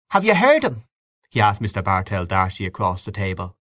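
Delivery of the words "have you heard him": raised high, eager question; Freddy's eager voice. A narrator's reading. Freddy eagerly asks D'Arcy across the table, high and eager. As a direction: sound keen to hear his view.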